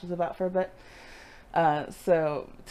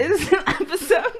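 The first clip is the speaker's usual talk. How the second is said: laughing; 10 semitones higher, 5 dB louder than usual. Real, not acted. Teasing over classifying episodes.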